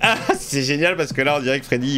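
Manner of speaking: ordinary talk, nothing marked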